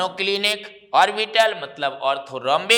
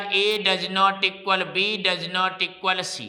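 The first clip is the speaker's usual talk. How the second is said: slow dictation; much slower than usual. Shown as a demonstration. Teacher dictating the condition to students, slowly. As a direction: slowly spell out each part. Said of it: "तीन आपको एक जैसा लिख देना है".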